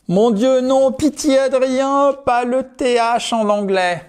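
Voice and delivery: high-pitched, hammed-up pleading; mock panic. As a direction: beg in exaggerated dread, pitch way up. Acted panic of a learner dreading TH. (supplication paniquée, surjouée, très aiguë)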